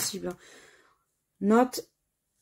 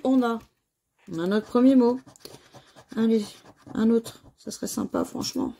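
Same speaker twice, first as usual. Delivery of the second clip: usual speaking voice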